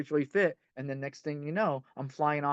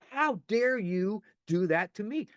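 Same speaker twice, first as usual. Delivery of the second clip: slow, higher-pitched indignant demand; acted outrage; 5 semitones higher, slower than usual. Betrayed partner confronting the one who cheated, acted outrage. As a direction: stretch it out in mock outrage. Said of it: "be enraged and go".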